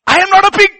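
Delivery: high, very loud cry of realization; acted indignant yell; outraged shout. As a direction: shout it out as the prodigal son. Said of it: "And he says".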